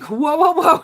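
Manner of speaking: imitating a startled whoa, pitch climbing; whoa reaction. Kendall reacting to mom going crazy, startled rising whoa. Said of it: "Kendall was like".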